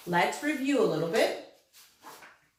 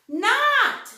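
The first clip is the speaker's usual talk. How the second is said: much higher, louder Not; excited reveal; 9 dB louder than usual. Shown as a demonstration. Teacher excitedly revealing the changed word. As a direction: announce the new word with delight. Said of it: "the word changed".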